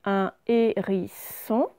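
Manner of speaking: HÉRISSON as HÉ-RI-SSON; slow, separated syllables in HÉRISSON; sounded out slowly. Shown as a demonstration. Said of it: "on va prendre mot par mot".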